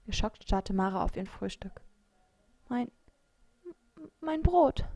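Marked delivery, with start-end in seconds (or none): 2.7-4.8 halting, then a high, loud lament; shocked stammer. A narrator's reading. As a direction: stammer it with a long gap after the first word. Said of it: "stammelte sie". (geschockt gestammelt)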